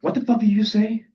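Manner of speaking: high, loud, incredulous demand; angry challenge. Acted. Alumnus confronting the narrator who corrected him, angry.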